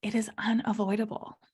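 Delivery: hushed, knowing whisper. Real, not acted. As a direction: whisper it like a truth. Stating failure will happen.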